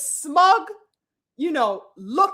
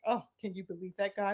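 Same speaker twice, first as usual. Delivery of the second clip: mock eye-roll aside; 6 semitones lower, 13 dB quieter, faster than usual.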